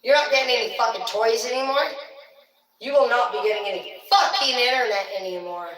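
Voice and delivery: loud parental telling-off; hammed-up shouting parent; mock angry punishment. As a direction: lay down the punishment at full voice. Acted parent punishing children.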